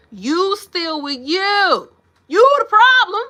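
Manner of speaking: loud, high, drawn-out call-out; big exasperated exclamation; hollered punchline. Real, not acted. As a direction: holler it slowly like a verdict. Climax of her rant, hollering.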